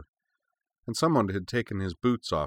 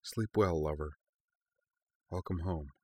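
murmuring; 7 dB quieter than usual. A narrator's reading. To a lover fading fast into sleep, murmuring. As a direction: murmur a gentle goodnight.

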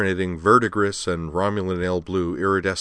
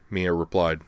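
ordinary delivery, nothing marked

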